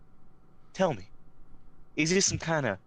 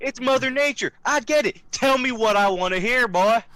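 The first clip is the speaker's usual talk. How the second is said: louder, higher, pushy demand; impatient demand; 10 semitones higher, 7 dB louder than usual. Acted. Interrogator pressing Fred for answers, loud impatient demand. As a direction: done with the excuses.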